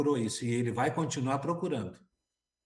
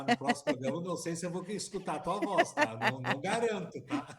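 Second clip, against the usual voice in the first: laughing while teasing; 5 semitones higher than usual. Real, not acted. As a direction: talk through real laughter. Co-host teasing about her singing.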